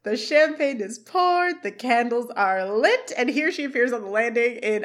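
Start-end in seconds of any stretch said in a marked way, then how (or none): 0.0-3.1 slow, drawn-out singsong seductive call; Amy. Retold or imitated. Wife calling down to her husband from upstairs, slow mock-seductive. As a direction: stretch every phrase in a mock come-hither voice. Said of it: "we hear Amy from upstairs say".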